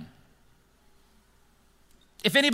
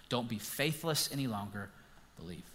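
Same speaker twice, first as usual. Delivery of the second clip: gentle invitation; 5 semitones lower, 10 dB quieter than usual. Retold or imitated. Jesus inviting Thomas.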